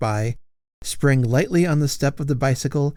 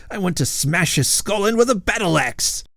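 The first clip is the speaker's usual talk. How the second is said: fierce knight; 8 semitones higher, 3 dB louder than usual. Acted. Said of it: "growled Sir Hugo".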